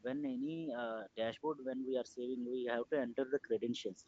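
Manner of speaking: faint, faraway-sounding voice; speaking too softly to hear; barely audible. Real, not acted. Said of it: "Your voice is low, [name]".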